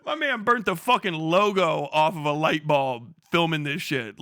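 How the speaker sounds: ordinary delivery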